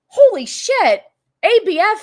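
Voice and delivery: sudden high excited squeal; surprised shriek. Real, not acted. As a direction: shriek with delight at the gift. Surprised by a big donation.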